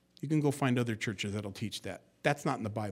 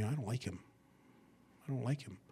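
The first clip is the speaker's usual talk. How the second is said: soft, tired complaint; 10 dB quieter, faster than usual. Retold or imitated. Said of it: "she would tell me".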